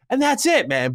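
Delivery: ordinary talk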